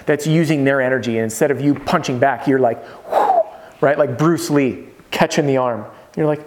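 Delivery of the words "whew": high whooshing exhale of a dodge; playful high dodge sound; mock dodge whoosh. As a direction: whoosh out like slipping a punch. Demo of dodging like Aikido.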